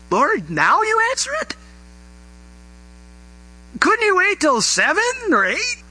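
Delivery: high, incredulous complaint to God; a hammed-up groggy protest to the Lord; mock exasperation. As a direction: whine it like you were woken at two. Preacher voicing sleepy annoyed prayer.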